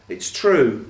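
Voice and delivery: plain reading voice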